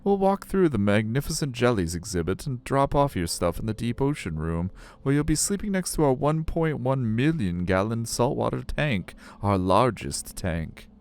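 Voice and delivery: high, swooping tour-guide voice; friendly aquarium guide. Acted. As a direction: announce the tour in a high, showy lilt.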